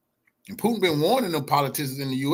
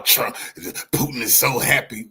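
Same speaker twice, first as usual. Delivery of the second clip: ordinary talk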